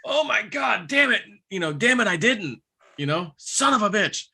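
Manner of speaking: high exasperated "damn it", mildly mock-cursing; cursing his own forgetfulness, half-joking; mad at myself. Joe retelling cursing himself for forgetting the offering, mock angry. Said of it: "getting mad, like, myself".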